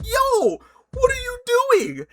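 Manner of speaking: sudden very high squeal of disbelief; shocked outcry. Retold or imitated. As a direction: jump way up in pitch, outraged. Friend mauls his hot dog.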